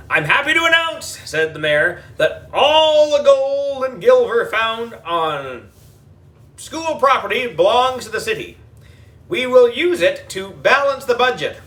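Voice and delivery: mayor addressing the TV camera; pompous politician. A narrator's reading. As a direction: announce it like a self-important mayor. Mayor speechifying.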